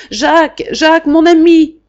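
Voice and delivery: loud, high desperate call; loud frightened cry of a friend's name. A narrator's reading. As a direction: call his name in panic. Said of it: "je me mis à crier bien fort".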